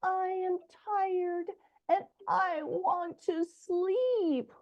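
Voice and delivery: slow, soft, high little voice; quiet, tired. A narrator's reading. Reader voices tired Little Bun at day's end, quiet and tired. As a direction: drag it out like a sleepy bunny. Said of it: "and quietly says".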